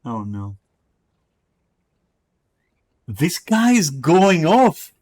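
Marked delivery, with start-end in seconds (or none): 3.1-4.9 drawn out slow and higher, stunned disbelief; amazed. Real, not acted. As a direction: stretch each word, half laughing in shock. Reacting to wild messages.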